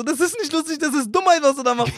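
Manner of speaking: high-pitched mock scolding; imitated scolding father. Father dismissing his son's videos, imitated scolding. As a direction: mimic dad dismissing it, on the edge of laughing. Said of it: "Der so".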